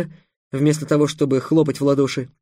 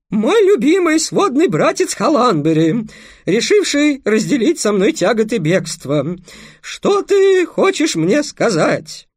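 high loud falsetto princess; boy playing a girl; 7 semitones higher, 4 dB louder, slower than usual. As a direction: overact the lady in falsetto. Pesya still playing princess. (мальчик пищит фальцетом за княгиню)

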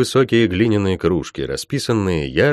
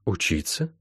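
low, much softer one-word reply; laconic; 3 semitones lower, 10 dB quieter than usual. A narrator's reading. Healer answering what must be done, laconic. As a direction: answer quietly and sparingly. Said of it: "лаконично отозвалась целительница".

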